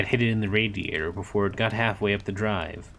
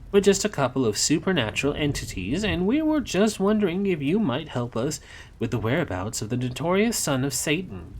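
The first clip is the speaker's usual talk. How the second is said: higher lilting sing-song; higher teasing sing-song delivery; 7 semitones higher than usual. Acted. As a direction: chirp it out mockingly, sing-song. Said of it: "in a sing-song voice".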